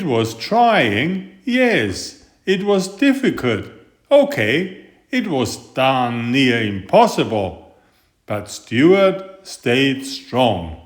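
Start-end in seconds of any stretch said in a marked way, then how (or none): none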